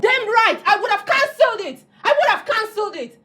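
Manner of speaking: angry outburst. Acted. Caterer furious at her friend's suggestion, heated outburst. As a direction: snap back in indignation.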